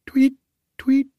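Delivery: high chirping tweet; bird chirp. A narrator's reading.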